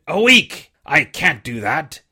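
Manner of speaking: put-on a weak old seaman coaxing the boy; dying buccaneer begging the boy for rum; old sick sea captain. Captain's defiant rant.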